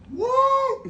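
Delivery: sudden yelp from the heat; high pained whoa. Real, not acted. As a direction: squeal it out high. Reacting to spicy wings.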